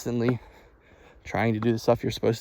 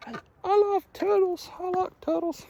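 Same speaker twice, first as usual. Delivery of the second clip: silly voice; 4 dB quieter than usual. Pretend. Silly turtle-lover voice.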